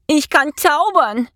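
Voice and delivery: high, drawn-out cry of triumph; jubilant. A narrator's reading. Mouse discovers he can do magic, jubilant. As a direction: cheer it out high and slow. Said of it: "jubelte Jonathan".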